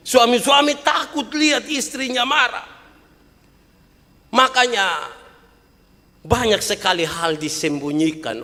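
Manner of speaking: high, loud, driving sermon voice; fiery pulpit delivery; preaching at full force. Acted. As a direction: hammer the point to the mothers.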